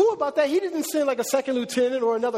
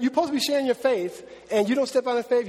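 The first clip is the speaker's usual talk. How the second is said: normal talking voice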